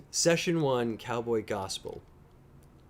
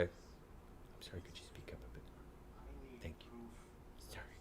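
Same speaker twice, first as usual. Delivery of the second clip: joking whisper; 23 dB quieter than usual. Pretend. Teasing his partner during the stream, whispered. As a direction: whisper it as if shushed in a theater.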